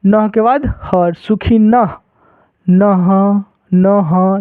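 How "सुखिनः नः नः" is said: नः with a clear ह after न; final h sound. Shown as a demonstration. Teacher drilling the visarga at the word's end. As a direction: say सुखिनः ending in an audible ह. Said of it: "last में ह बोलना है".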